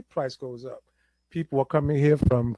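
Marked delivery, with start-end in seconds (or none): none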